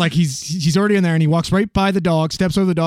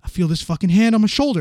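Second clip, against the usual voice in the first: ordinary talk, nothing marked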